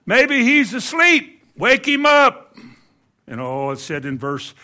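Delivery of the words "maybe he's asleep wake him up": slow, loud, high taunt; drawn-out mocking yell; Elijah. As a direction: holler the jeer across at them. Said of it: "he looks over here".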